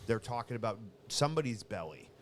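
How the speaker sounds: ordinary talk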